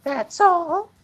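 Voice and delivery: put-on high falsetto, happy girl voice. Persona relieved by her summoner's request, happy falsetto girl voice. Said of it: "she asked happily".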